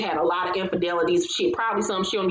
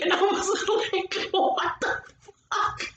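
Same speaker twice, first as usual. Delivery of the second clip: laughing; 10 semitones higher than usual. Real, not acted. Laughing in disbelief.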